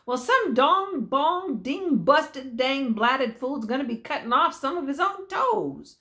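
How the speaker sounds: plain reading voice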